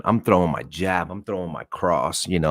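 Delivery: ordinary talk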